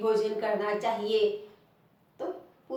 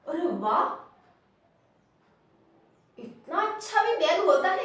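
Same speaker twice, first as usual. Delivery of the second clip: higher, louder mock astonishment; acted amazement; 5 semitones higher, 4 dB louder than usual. Shown as a demonstration. Teacher demonstrates surprise at a fine bag, amazed. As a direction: exclaim as if amazed. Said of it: "कोई आश्चर्य से".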